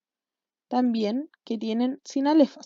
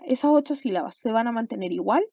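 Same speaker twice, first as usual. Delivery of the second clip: ordinary delivery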